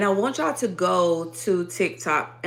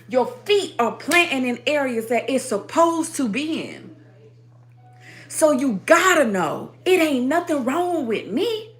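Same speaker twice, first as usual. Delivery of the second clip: loud, rhythmic, hammering sermon delivery; fervent preaching; 6 semitones higher, 6 dB louder than usual. Acted. Preaching to her stream audience, fervent and rhythmic. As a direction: preach it with fire to the congregation. Preaching to viewers at full force.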